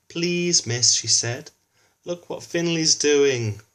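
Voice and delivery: put-on high, eager tattletale child; telling tales. A narrator's reading. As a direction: pipe up to tell on Finley.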